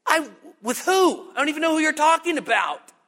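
high-pitched, stammered start, then quick protest; acted denial. Peter denying Jesus to a servant girl, high-pitched protest.

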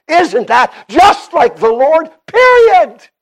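rising pulpit fervour, punching the last word; emphatic preaching. Acted. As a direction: build to a loud, high climax. Preaches at full force.

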